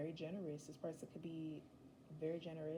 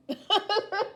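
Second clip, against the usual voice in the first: loud high laugh; 16 dB louder than usual. Real, not acted. Laughs at own acted scene.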